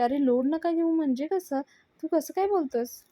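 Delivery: retold exasperation, pitch jumping up; indignant challenge. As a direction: throw the words back at him in disbelief. Avni indignantly challenges Raja's reassurance.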